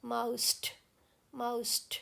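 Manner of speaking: quiet careful माउस्ट; soft diphthong. Shown as a demonstration. Teacher softly repeating the correct माउस्ट. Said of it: "दोनों sound हमें सुनाई देती हैं".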